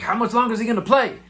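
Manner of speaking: eager question. Retold or imitated. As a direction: rush the question out, impatient. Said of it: "And I'm like".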